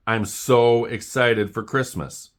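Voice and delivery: bright gushing delight, drawn out; thrilled about Christmas, savour it; excited. Acted. Oxpecker gushing to Jim about Christmas, excited.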